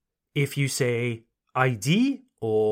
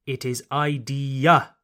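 ordinary talk, nothing marked

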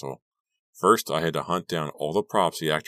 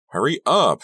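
loud high call across the stage; shouting to a friend from the stage; 10 semitones higher, 9 dB louder than usual. A narrator's reading. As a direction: call out, raised and urgent. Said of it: "she called".

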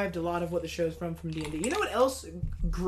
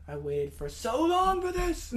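drawn-out, higher-pitched relief; overjoyed fan; 8 semitones higher, slower than usual. Acted. Fan finally getting the finale, drawn-out and overjoyed. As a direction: stretch it out like an overdue payoff.

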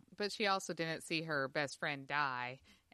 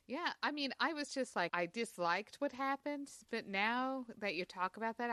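usual speaking voice

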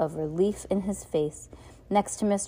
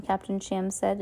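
plain reading voice

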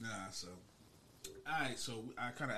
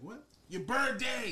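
high, loud snapping repeat of the question; impatient yell; 12 semitones higher, 7 dB louder, slower than usual. Retold or imitated. Trini partner yelling at a patient, a high loud impatient repeat. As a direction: yell it like they can't hear you. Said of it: "He's yelling".

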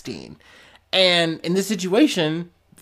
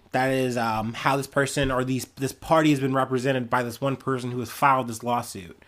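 normal talking voice

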